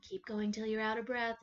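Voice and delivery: ordinary delivery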